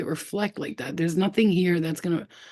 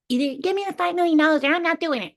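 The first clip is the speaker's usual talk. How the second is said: high, fast squeaky ultimatum; elf voice; 11 semitones higher, faster than usual. Retold or imitated. Little elf haggling with the alien, high squeaky voice. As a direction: rattle it off as a tiny stubborn elf. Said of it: "I was talking like an elf".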